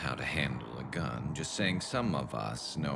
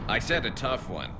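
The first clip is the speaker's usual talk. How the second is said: teasing taunt; 7 semitones higher, 4 dB louder than usual. Acted. Teasing demand after laughing.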